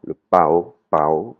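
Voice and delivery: PAO with a bent, broken pitch; "pao" as a broken Thai tone. Shown as a demonstration. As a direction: break the tone within "pao". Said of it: "l'intonation elle est cassée".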